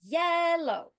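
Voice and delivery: YEL in "yellow" high, loud and held; stress on first syllable. Shown as a demonstration. Teacher giving an example word, YEL stressed in "yellow". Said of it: "on the first syllable".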